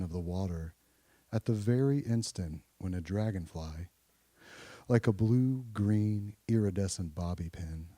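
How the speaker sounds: measured, low poetry recital with pauses; poem reading. Real, not acted. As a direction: read the poem low, pausing between phrases. Reads a poem aloud.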